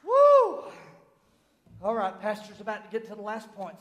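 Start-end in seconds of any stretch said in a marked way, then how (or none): 0.0-0.7 high, loud "woo"; exultant whoop. Real, not acted. Preacher releasing the climax, exultant high whoop.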